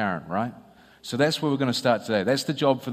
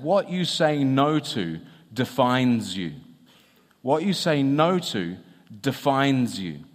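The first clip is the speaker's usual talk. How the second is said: slow, measured ad line with pauses; quoted ad slogan; slower than usual. Retold or imitated. Speaker quotes actor's beer advert slogan, slow and measured. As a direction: deliver the slogan slowly, spacing out the phrases. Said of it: "he began the ad by saying".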